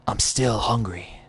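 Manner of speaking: weak, slow whisper. Acted. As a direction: breathe it out, starving. Starving man speaks weakly.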